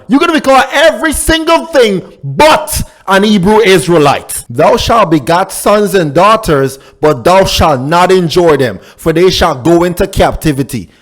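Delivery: ordinary talk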